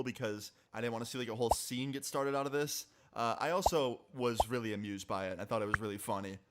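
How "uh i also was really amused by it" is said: ordinary talk, nothing marked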